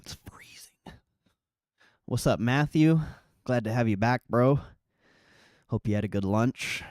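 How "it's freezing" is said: slow breathy whisper. Real, not acted. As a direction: whisper it slowly, half to yourself. Complaining about cold outside.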